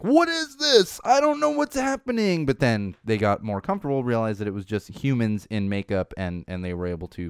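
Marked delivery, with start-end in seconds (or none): 0.0-2.4 much higher, baffled squeaky voice; confused cat voice. Retold or imitated. Cat on set meeting aliens, high bewildered voice. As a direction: voice the startled cat, pitched way up. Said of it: "the cats are like".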